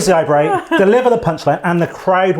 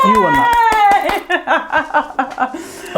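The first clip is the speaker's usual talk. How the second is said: much higher, louder laughing shriek; 3 dB louder than usual. Real, not acted. Friend shrieking with laughter after winning. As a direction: cackle with delight.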